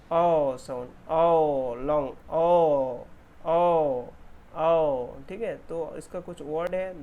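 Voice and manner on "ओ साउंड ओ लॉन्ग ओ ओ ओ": loud, held "ओ"; long aw sound. Shown as a demonstration. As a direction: call out the long vowel "ओ". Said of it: "sound क्या produce करता है". (जोर से लंबा "ओ")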